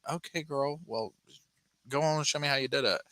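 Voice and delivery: mock cop voice pitched high, a little soft; sassy cop. Cop coaxing the suspect to show how, mock sassy. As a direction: tease her into showing it. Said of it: "the cop's like".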